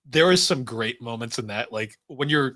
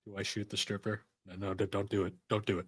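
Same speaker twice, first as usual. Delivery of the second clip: very soft, lowered voice; hushed self-talk; 4 semitones lower, 12 dB quieter than usual. Retold or imitated. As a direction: talk yourself out of it under your breath. Acting out tempted inner self-talk.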